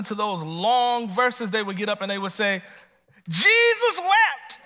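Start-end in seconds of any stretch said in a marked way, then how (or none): 3.3-4.6 much higher, louder, slow mock-proud proclamation of "Jesus wept"; proud squeak. Preacher mimicking a churchgoer proudly reciting "Jesus wept", high and slow. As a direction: announce "Jesus wept" like a grand feat, squeaky and drawn out. Clueless congregant's proud short verse.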